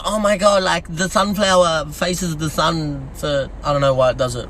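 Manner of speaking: low, slow mock Aussie voice; dropped-pitch Aussie caricature; imitated Aussie. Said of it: "Aussies will reply to this and they go".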